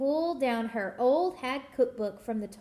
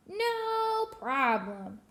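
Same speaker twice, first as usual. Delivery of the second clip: witch voice; 6 semitones higher, much slower than usual. Acted. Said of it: "repeated line".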